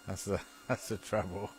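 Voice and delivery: usual speaking voice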